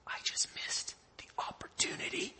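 hushed, envious aside; whispered disbelief. Acted. Disciples watching Peter walk on water, whispered disbelief. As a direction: whisper it as if you missed out.

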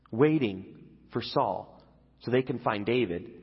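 ordinary talk

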